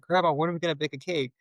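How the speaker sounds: put-on a little boy nagging grandma; imitating his child self begging to bake; eager kid voice. Retold child pestering grandma.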